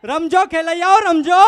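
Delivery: loud festive call to the crowd; rally the dancers, full voice. Real, not acted. Host calling the garba crowd loudly.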